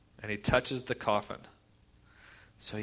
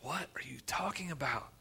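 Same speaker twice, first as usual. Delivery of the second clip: hushed, incredulous question; dumbfounded; 3 semitones higher, 10 dB quieter than usual. Retold or imitated. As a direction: ask softly in disbelief. Onlookers dumbfounded at Jesus' command.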